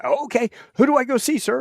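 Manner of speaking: lightly voiced eager eighteen-year-old; eager young private. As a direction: play the clueless keen kid. Eager young Marine voiced in story.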